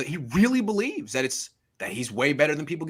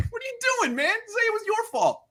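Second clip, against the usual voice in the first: exasperated plea. Real, not acted. Exasperated rant at the player.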